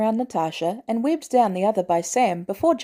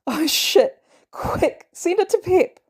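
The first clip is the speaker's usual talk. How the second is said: breathless laughing; 9 semitones higher, slower than usual. A narrator's reading. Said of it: "could barely breathe and speak".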